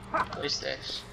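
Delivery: hushed puzzled whisper. Real, not acted. Gamer whispering to himself at a strange find. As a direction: breathe the question under your breath.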